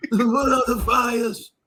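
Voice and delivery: mock a melodramatic pep talk like a wrestling promo; wrestling-promo parody. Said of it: "He think this is W W F".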